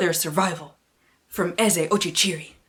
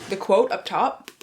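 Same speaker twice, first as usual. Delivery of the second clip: ordinary talk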